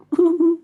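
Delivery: a tiny spider's giggle; high little chuckle. A narrator's reading. Abigail chuckles at Timbertwig, a high little giggle. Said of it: "Abigail chuckled".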